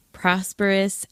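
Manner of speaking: normal talking voice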